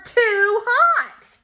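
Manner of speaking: put-on high, stretched-out toddler yell; mock yell. A narrator's reading. Child complaining about peas at supper, mock yelling. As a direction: wail it in childish protest. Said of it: "that means she's yelling it".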